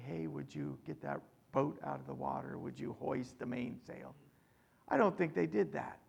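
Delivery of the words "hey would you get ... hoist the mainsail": soft, quick, offhand asking, nothing like a captain; a timid captain mumbling his orders; mock casual quiet request. Preacher mocking a timid captain, soft and offhand. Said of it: "you don't talk like this".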